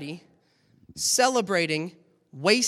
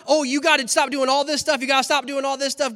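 mock nagging; 6 semitones higher, 6 dB louder, faster than usual. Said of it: "But it's not".

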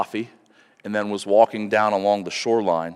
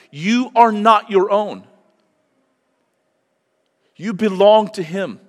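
preaching at full force; 6 semitones higher, 4 dB louder than usual. Acted. Pastor urging the congregation to surrender their lives, impassioned preaching. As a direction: drive it home to the congregation.

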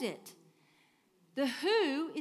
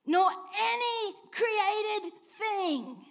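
slow, higher and louder, voice crying out; 7 semitones higher, 6 dB louder, much slower than usual. Real, not acted. Pastor preaching the climax to the congregation, crying out. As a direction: cry the line out with fervour.

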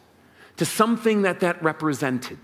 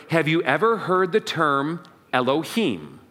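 usual speaking voice

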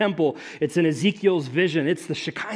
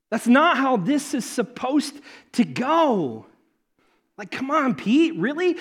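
exasperated Elijah; 10 semitones higher, 5 dB louder, slower than usual. Retold or imitated. Elijah voiced rebuking Peter.

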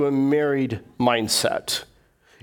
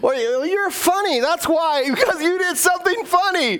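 amused reply; 13 semitones higher than usual. Retold or imitated. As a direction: laugh it off kindly. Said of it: "it's like".

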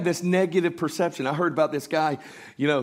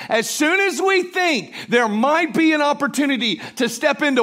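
full-force preaching; 10 semitones higher, 7 dB louder than usual. Real, not acted. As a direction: build it like a pulpit shout.

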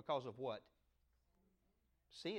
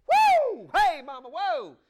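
whooping high and loud, hammed-up; excited whoop; 11 semitones higher, 17 dB louder, much slower than usual. Adam whooping at Eve, loud hammed-up holler. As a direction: holler like you just saw your sweetheart.

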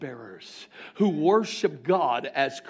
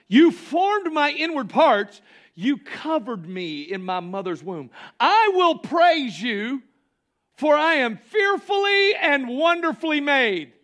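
loud, high proclaiming of the psalm; declaring scripture; 10 semitones higher, 5 dB louder than usual. Shown as a demonstration. Pastor shows the congregation how to speak to God, proclaiming. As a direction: proclaim it like a victory shout. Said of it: "did you say that this morning".

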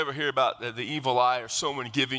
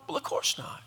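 whispered aside; 5 dB quieter than usual. Acted. Whispered aside mid-sermon.

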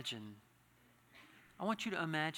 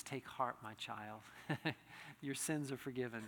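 soft, much quieter tender voice of Jesus, with a small laugh; gentle quoted blessing; 5 semitones lower, 7 dB quieter than usual. Acted. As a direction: hush it like a gentle blessing to a child. Jesus's words quoted softly.

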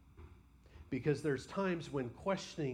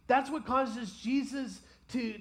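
ordinary talk, nothing marked

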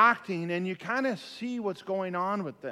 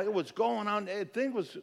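ordinary talk

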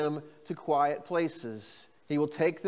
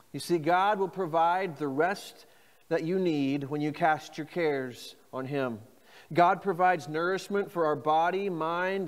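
ordinary delivery

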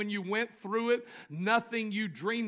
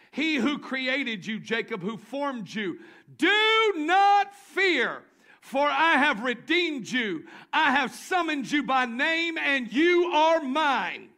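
slow, loud, higher scripture proclamation; preaching at full force; 6 semitones higher, 8 dB louder, slower than usual. Acted. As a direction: cry out the promise to the church.

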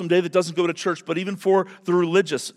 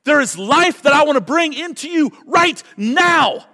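a commanding call to come out to life; voice of Jesus calling; 9 semitones higher, 6 dB louder than usual. Acted. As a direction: boom it out like Jesus at the tomb. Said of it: "hear his voice say to us".

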